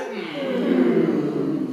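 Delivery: low, sad hum; a sorry little hum. Shown as a demonstration.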